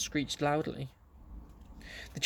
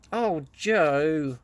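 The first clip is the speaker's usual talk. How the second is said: pleading cry; 2 semitones higher, 5 dB louder than usual. A narrator's reading. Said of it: "cried Beth".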